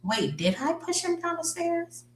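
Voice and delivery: doubting her own memory, high and soft; bewildered. Retold or imitated. Wife doubting she pushed husband, high and bewildered. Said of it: "So now she's just like".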